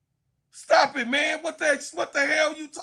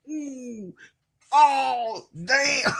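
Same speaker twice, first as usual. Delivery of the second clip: imitated amazed reaction; much slower than usual. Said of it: "Kennedy Carter was like".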